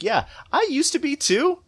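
wry high quoted voice; high ironic quote. Retold or imitated. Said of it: "I was like".

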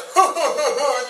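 high, put-on jeering laugh; mocking laugh. Father pretending to mock his son, jeering fake laugh. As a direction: fake a laugh at a child's expense. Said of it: "If you saw me mocking my son".

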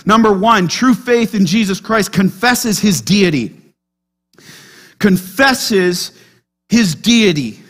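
ordinary delivery

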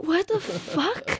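high, slow, drawn-out incredulity; acted-out stunned outrage; shocked disbelief. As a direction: stretch it out in appalled disbelief. Retelling shocked reply to boatman.

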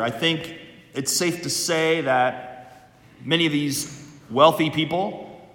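usual speaking voice

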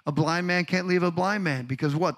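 normal talking voice

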